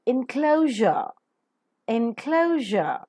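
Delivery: "enclosure" drawn out slowly, in-kloh-zhuh; practice model pronunciation. Shown as a demonstration. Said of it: "That's right".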